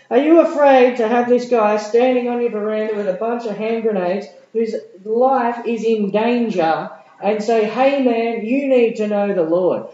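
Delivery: high, loud, excited preaching; preaching at full force. Acted. Preacher rousing the congregation with a big, high, loud voice. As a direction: lift the crowd, rising high and loud.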